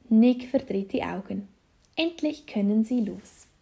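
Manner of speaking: ordinary delivery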